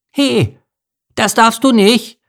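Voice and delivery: put-on very high, loud child's voice; loud piping child, words drawn out; high childish scolding. A narrator's reading. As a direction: scold like an indignant little mouse kid. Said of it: "schimpfte auf einmal eine hohe kindliche Stimme".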